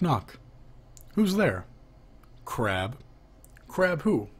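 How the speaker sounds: ordinary delivery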